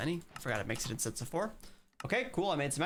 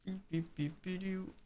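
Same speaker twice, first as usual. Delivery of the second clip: soft robotic beeps; mock beeping; 3 semitones higher, 7 dB quieter than usual. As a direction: imitate a machine beeping quietly. Mocking the ad break.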